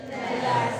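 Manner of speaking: ordinary talk, nothing marked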